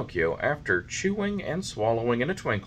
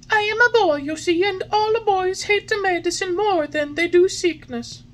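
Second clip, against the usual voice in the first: put-on high, loud happy boy voice; cheerful puppet; 7 dB louder than usual. A narrator's reading. Puppet cheering to the fairy, full of life. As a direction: bounce with new life.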